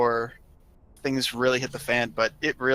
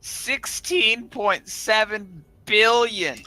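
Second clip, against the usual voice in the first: stunned readout; 8 semitones higher, much slower than usual. Real, not acted. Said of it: "Go ahead and read that total out".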